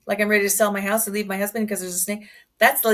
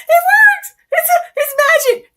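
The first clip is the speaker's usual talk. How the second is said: much higher, loud cry of amazement; ecstatic shout; 9 dB louder than usual. Retold or imitated. New hypnotist at first hand raise, screaming with delight. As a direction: yell it like you just saw real magic.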